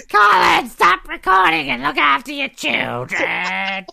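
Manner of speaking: high, drawn-out scolding wife; Jamie impression. Co-host playing wife scolding recording husband, high mock nagging. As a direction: shriek the name, then scold slowly. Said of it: "imitating Jamie, where I do the voice".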